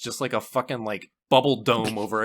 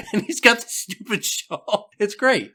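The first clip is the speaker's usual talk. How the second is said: usual speaking voice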